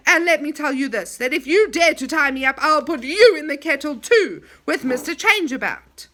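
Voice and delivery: loud, bold warning; fierce threat. Acted. Moonface threatening the enchanter with the kettle, loud. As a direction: threaten him boldly. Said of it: "went toward the enchanter fiercely".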